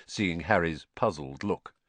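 usual speaking voice

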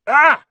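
sudden high loud cry; sharp yelp of pain. A narrator's reading. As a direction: yelp as if bitten. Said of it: "Norbert had just bitten him".

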